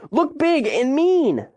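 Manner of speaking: high, drawn-out yell; shouted order. A narrator's reading. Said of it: "she shouted to Cooper".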